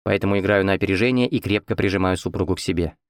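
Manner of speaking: ordinary delivery, nothing marked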